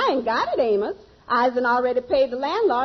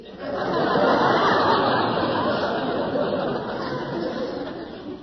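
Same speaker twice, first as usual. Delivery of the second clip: breathy laugh; 11 semitones higher than usual. Real, not acted. Sapphire laughs at steak joke.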